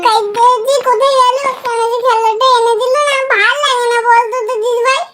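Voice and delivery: loud, whining, aggrieved; loud whiny complaint.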